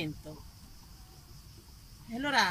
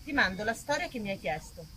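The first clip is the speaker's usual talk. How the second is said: reading a letter; 4 semitones lower than usual. Acted. Actress reads her friend Marina's letter to the audience, light and intimate. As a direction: read it like a friendly letter from Naples.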